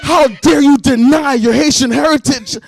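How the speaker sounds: loud, high, slow mock-outraged shout; hammed-up indignant scolding, stretching the words; mad. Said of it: "got mad at me".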